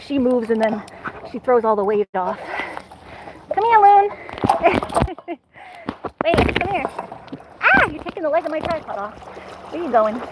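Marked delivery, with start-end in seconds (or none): 3.5-6.8 raised, higher call to the dog. Real, not acted. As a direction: call her over across the water. Calling dog on paddleboard.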